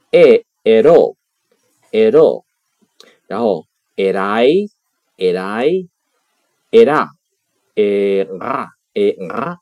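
slow, loud textbook recitation of each ending; careful classroom drill voice; conjugation drill. Shown as a demonstration. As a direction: spell out e, ero, erai, era clearly like a teacher. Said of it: "它的规则变化如下".

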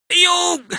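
very high cartoon yelp; startled yelp. A narrator's reading. Mantis gets the mushroom thrown at it, yelping. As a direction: yelp as if something lands on you.

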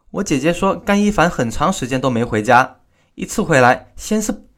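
usual speaking voice

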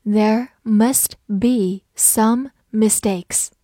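slowly, each word set apart; slow read-along with pauses for repeating. Shown as a demonstration. Teacher has learners read along after her, slow reading. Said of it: "跟着我慢读一遍".

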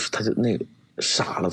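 ordinary talk

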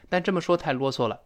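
ordinary talk, nothing marked

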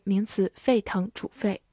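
ordinary talk

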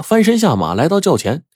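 ordinary delivery

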